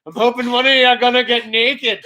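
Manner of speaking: mock pervy caller, laughter close behind; imitated Zoom creep. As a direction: play the lurking intruder for laughs. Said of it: "He's like".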